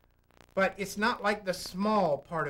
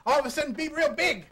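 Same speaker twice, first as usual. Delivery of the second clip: bigger, higher, quick burst of projection; stage-sized burst, pitched up; suddenly big; 9 semitones higher, 4 dB louder, faster than usual. Shown as a demonstration. Acting out sudden bigness.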